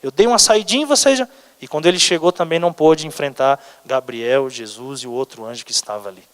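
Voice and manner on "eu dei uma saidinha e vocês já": quick, mildly indignant telling-off; light mock reprimand to underlings; mild scolding. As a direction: scold them without really shouting. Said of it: "ele deu uma bronca nos anjos dele".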